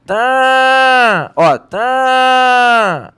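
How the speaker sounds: high, drawn-out nasal vowel being blocked; sustained pinched-nose demo. Shown as a demonstration. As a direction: hold the vowel long while pinching your nose. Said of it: "Ele tá prendendo".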